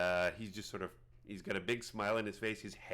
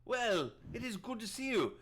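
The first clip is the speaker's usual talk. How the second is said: high-pitched hermit druid, pleased; put-on high voice, cordial greeting; Prattis; 12 semitones higher than usual. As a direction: greet them as an eccentric hermit, pitched well up. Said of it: "his name's Prattis".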